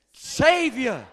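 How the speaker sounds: full-voiced shout. Shown as a demonstration. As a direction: belt it out to rouse the congregation. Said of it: "say it loud again".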